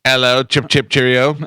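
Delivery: hammed-up British greeting; fake British accent. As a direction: rattle off cartoon British pleasantries. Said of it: "that was a fake accent".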